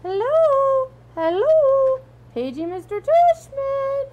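put-on very high, loud, drawn-out old lady; fake high old lady voice. Dad joking to Augie about the loudspeaker, fake old lady voice. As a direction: page him in a hammed-up old lady falsetto. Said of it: "using a fake high old lady voice".

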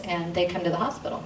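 ordinary talk, nothing marked

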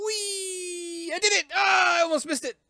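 high gleeful shouting; high excited shouting; 1 loud joyful whoop. Real, not acted. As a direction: shout your win with relief. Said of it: "I need to stop being so loud".

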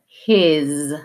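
"his" ending in a buzzing z; z-sound demo; voiced S. Shown as a demonstration. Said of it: "have that voiced S sound".

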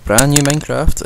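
big loud YouTube greeting; loud intro. Real, not acted. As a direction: shout the hello to open.

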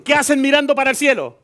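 high loud rebuke; angel's rebuke. Acted. Angel scolding the disciples staring upward, high and loud. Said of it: "Y le dijo".

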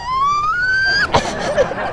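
very high scream of fright and fun; shriek. Real, not acted. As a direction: shriek out on the ride. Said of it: "mà la dữ vậy".

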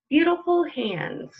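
slow, gentle picture-book read-aloud with long pauses; reading to children. Acted. Librarian reading a picture book to children at home, slow storytelling voice. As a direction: let each question hang for little listeners.

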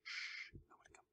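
hushed whisper. Pretend. Hiding from visitors in his hole, whispering. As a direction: breathe it out secretly.

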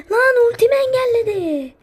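high, loud outraged cry; loud disbelieving outburst; frustrated yell. Real, not acted. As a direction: yell at the blocked ultimate. Ultimate blocked in game.

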